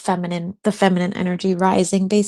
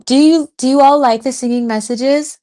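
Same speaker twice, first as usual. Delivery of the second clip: excited; 6 semitones higher, 7 dB louder than usual. Real, not acted. Asking viewers eagerly.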